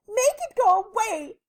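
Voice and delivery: high, loud plea; high-pitched desperate demand; pleading lady. A narrator's reading. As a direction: beg like a frantic storybook lady. Lady begs for help.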